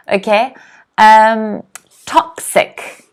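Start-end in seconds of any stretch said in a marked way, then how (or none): 2.0-3.0 toxic, first syllable raised high; stress on TOXIC. Shown as a demonstration. Teacher modelling TOXIC with stress on the first syllable. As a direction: lift TOX in toxic up high and let ic drop. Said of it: "Notice the stress again there".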